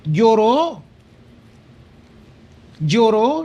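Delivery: "lloró" said high and loud, with a falling tone; emphatic repeat of lloró. Real, not acted. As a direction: stress "lloró" with weight. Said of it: "emphatic repetition". ("lloró" dicho agudo y fuerte, con énfasis)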